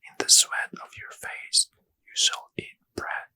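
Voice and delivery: plain reading voice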